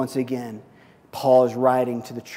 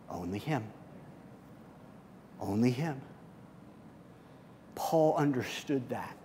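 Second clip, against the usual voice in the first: hushed intimate preaching; 5 dB quieter than usual. Acted. Pastor urging the congregation softly, hushed and intimate. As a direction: pull the room in with a near-whisper.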